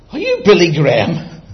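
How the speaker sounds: loud, excited question; astonished recognition. Retold or imitated. Traffic policeman recognising Billy Graham, astonished. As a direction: blurt it out, loud and incredulous.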